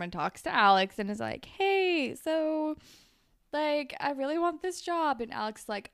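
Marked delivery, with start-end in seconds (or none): 1.4-5.2 high-pitched, hesitant mock pleading; awkward sweet-talk. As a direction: imitate someone buttering up a rival, pitched up. Voicing Paige asking a rival.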